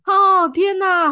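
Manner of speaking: slow, high and loud outrage at the ex; oh my god. Real, not acted. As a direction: stretch it slowly, scandalized. Reacting to awful boyfriend story.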